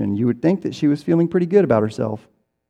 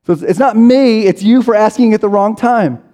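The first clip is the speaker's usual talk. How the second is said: higher, louder indignant mock voice; a higher pitched, louder put-upon excuse; mock blame; 7 semitones higher, 5 dB louder than usual. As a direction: toss the blame back, hammed-up. Mock excuse-maker blaming listener.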